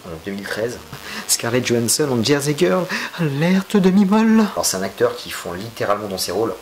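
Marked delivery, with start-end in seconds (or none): 1.3-4.4 high, loud siren-like announcement; mock alarm. Joking alert to viewers about Scarlett Johansson, rising mock alarm. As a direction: rise to a drawn-out, very high alert.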